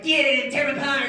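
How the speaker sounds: high, loud attack order; fierce shouted command. Acted. Teacher sets her Dobermans on boy, fierce shout. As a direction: shout the dogs on like a crazed owner.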